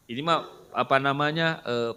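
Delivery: normal talking voice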